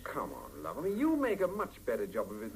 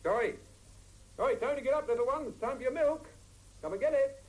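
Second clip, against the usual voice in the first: high, loud coaxing call; calling the child; 4 dB louder than usual. Acted. Father waking his little daughter, high loud calling voice. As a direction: sing out to wake the little one.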